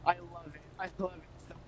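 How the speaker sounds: like a crackly cordless phone cutting out; fading in and out. Real, not acted. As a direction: voice dropping out over a bad line. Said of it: "You're sort of fading in and out a little".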